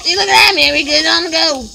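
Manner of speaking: high, loud burst of excitement; excited boast. Pretend. Cook showing the food is ready, in an excited shout. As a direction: crow about being good to go.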